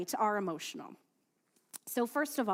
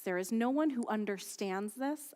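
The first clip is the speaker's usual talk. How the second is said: usual speaking voice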